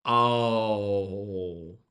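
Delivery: ordinary delivery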